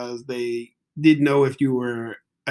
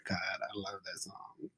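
swooning; 5 semitones lower, 19 dB quieter than usual. Real, not acted. Host swooning over the song.

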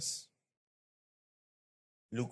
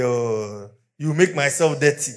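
louder, higher sneering disgust; mock disgust; 4 semitones higher, 15 dB louder than usual. Churchgoer mocking snobs who shun a poor boy, disgusted. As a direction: sneer it like a snob recoiling. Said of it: "you say".

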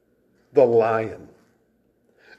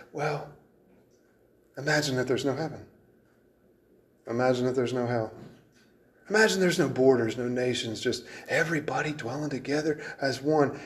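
soft, mocking parody of a dreamy anthem; mock humanistic dreaminess; 7 dB quieter than usual. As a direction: hush it like a naive dream to ridicule it. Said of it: "sing something silly and humanistic like".

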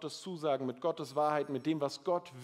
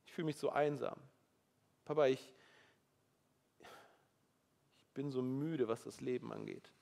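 weary confession; 3 semitones lower, 4 dB quieter than usual. Pretend. Example prayer, weariness.

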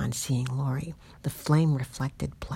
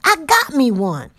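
loud, higher, gloating shout; gleeful yell; 10 semitones higher, 12 dB louder than usual. Acted. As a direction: crow over the catch. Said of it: "he yelled".

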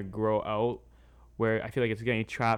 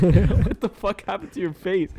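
loud, high, laughing shocked dad; 8 semitones higher, 8 dB louder than usual. Retold or imitated. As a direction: blurt it out in laughing disbelief. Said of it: "He's like".

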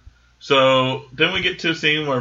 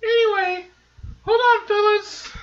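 female voice. Acted. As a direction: stretch it out in falsetto. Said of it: "I can't do it".

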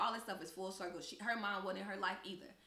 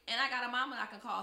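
1 defiant retort; 5 semitones higher, 8 dB louder, faster than usual. Retold or imitated.

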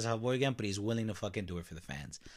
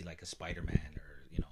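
whispered aside; 4 semitones lower, 8 dB quieter, faster than usual. Real, not acted. Trailing aside.

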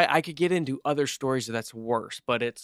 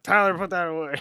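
higher-pitched imitation of a dad calling out; mock parental scolding; 5 semitones higher than usual. Imitating a coworker telling off his son, half-hearted scolding. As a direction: call to the kid in mock reproof.

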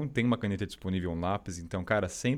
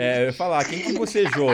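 loud teasing; 3 semitones higher, 10 dB louder than usual. Real, not acted. Teasing whoever yawned on call.

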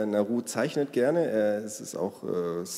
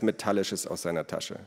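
plain reading voice

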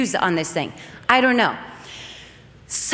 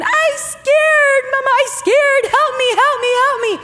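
put-on very high, louder little-boy wail; scared child; 14 semitones higher, 5 dB louder than usual. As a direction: imitate a frightened child begging mama. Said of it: "sound so afraid and so scared".

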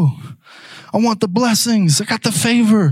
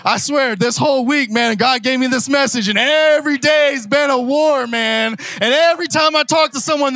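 ordinary talk, nothing marked

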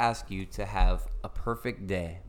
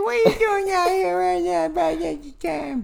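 high, loud, put-on voice calling out a name; high mock greeting; 7 dB louder than usual. Greeting Jesse out of nowhere in a high, loud, put-on voice.

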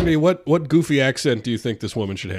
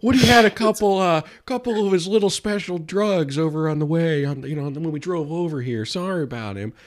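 high put-on actor voice; Owen Wilson; 5 semitones higher than usual. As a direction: apologise for a pal in a raised impression voice. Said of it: "I'll be Owen Wilson".

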